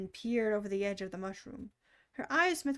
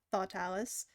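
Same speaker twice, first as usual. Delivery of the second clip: ordinary delivery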